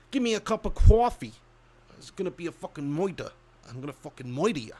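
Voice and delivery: exaggerated Brooklyn tough-talk accent. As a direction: lay on a thick mock Brooklyn accent. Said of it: "How do Brook-, people from Brooklyn speak?".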